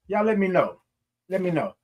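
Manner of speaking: ordinary talk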